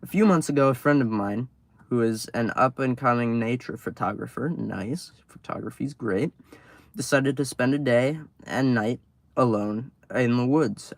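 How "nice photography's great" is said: plain reading voice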